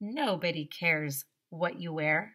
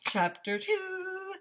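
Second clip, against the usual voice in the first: dramatic announcer; 12 semitones higher, 3 dB quieter, much slower than usual. Real, not acted. Announcing chapter.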